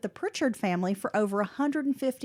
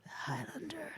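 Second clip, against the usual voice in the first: breathy movie-trailer whisper; dramatic whisper; 8 semitones lower, 13 dB quieter than usual. Acted. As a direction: hush it like a trailer voiceover. Echoing the movie line.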